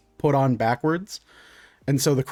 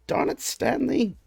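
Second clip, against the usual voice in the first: higher, softer grumble at the squid; annoyed shopkeeper; 10 semitones higher, 4 dB quieter than usual. Acted. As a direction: scold the squid under his breath. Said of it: "he goes".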